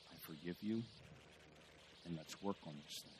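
soft, low-key retold apology; quiet tender forgiveness. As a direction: say it softly to the wife, gently. Husband forgiving his wife.

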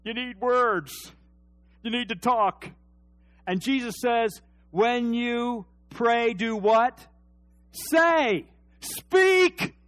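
loud, high preaching shout; rising to a preacher's shout; full-force preaching. Acted. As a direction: build the sermon to a cry.

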